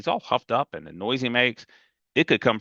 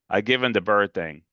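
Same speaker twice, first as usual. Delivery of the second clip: ordinary delivery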